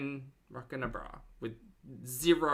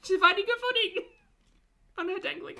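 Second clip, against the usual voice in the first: much higher, loud squeaky repeat; mock squeal; 8 dB louder than usual. Real, not acted. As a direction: squeal it back mockingly. Repeats the joke mockingly.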